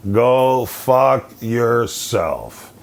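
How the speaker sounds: very slow, word-by-word dismissal; retold slow, measured put-down; deliberate retort. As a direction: draw out each word of the insult. Retold comeback to complaining dad.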